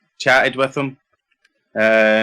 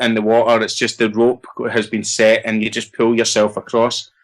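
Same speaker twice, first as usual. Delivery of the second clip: ordinary delivery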